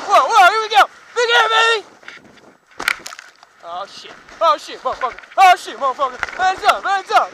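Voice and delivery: loud high thrill-ride yelling; on a roller coaster. Real, not acted. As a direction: scream through every bump. Riding jumps, shouting.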